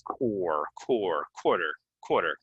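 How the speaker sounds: "Core, core, quarter" with an or vowel; syllable drill. Shown as a demonstration. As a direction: isolate KWOR, then say "quarter". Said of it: "common way to say this".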